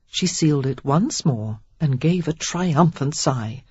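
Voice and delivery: ordinary delivery, nothing marked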